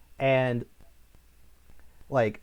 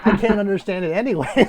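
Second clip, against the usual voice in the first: laughing; 6 semitones higher, 9 dB louder than usual. Real, not acted. Laughing about the mumbled dialogue.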